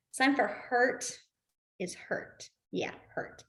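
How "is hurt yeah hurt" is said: breathy whisper, voice dropped away. Shown as a demonstration. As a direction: mouth the word hurt under your breath. Mouthing signs to class.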